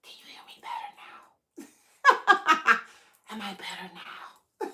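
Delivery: breathy whisper, voice dropped right down; hushed test whisper with a giggle. Shown as a demonstration. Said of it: "So I'm gonna bring my voice down a little bit".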